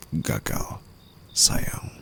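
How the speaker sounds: soft taunting whisper; a breathy, condescending whisper; mocking whisper. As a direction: pity them with contempt. Mocking the failure.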